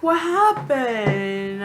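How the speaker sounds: very slow, drawn-out put-on question; exaggerated sluggish staffer voice; mock hospital staff. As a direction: drag it out as unhurried staff.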